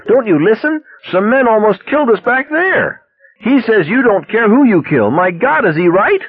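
very high, frantic outcry; wild cry. A narrator's reading. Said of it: "she cried wildly".

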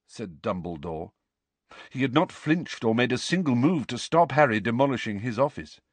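usual speaking voice

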